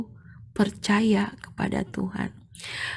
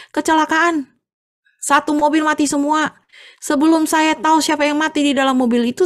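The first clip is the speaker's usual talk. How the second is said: alarmed news; 7 semitones higher, 5 dB louder than usual. Acted. Neighbour reports fatal accident.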